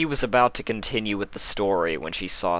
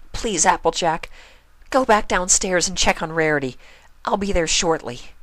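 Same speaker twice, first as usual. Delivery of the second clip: high, louder urgent plea; pleading Pinkie; 12 semitones higher, 5 dB louder than usual. A narrator's reading. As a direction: beg her to leave.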